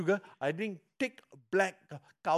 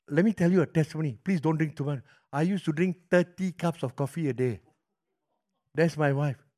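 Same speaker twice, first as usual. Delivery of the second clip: normal talking voice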